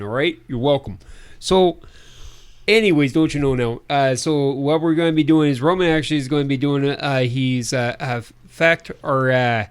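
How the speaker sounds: put-on Midwestern announcer; Midwestern accent. Co-host announces projects in a put-on Midwestern accent. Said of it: "a decent Midwestern accent".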